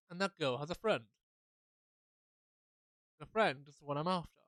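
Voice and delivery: step-by-step explanation with pauses. Acted. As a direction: set out each link separately. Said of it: "I'll say it slower".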